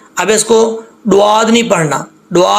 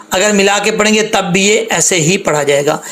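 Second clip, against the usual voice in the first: ordinary talk, nothing marked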